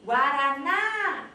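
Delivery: drawn-out gate call, rising on the last syllable; stretched shout swelling on the stressed syllable; calling out word. Shown as a demonstration. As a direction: call GUARANÁ out long and loud, lifting the final NÁ. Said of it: "Então, eu chamei".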